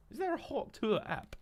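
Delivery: a sudden high-pitched "is there?"; surprised. Real, not acted. Streamer reacting to chat, shocked high question. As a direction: Hawk Tour app asked with a high squeak of disbelief.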